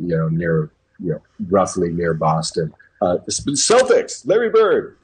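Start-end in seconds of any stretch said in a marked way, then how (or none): 3.3-5.0 much higher and louder, slow excited exclaim; mock the locals lighting up at the names; imitated excited fan shouting out names; imitating eager fans. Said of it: "Anytime I would tell somebody".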